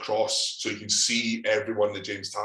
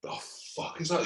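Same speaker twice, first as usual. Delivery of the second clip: put-on baffled old guys; 8 semitones higher, 5 dB quieter than usual. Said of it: "these old guys like".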